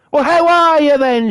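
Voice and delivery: high, bellowed mock greeting; imitating a woman who shouts small talk; loud Norfolk shouting. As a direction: blare it like a foghorn. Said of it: "as loud as she fucking can".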